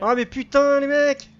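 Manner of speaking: loud, high frustrated cry; exasperated shout. Real, not acted. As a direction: blurt it out in alarm. Reacting to friendly fire.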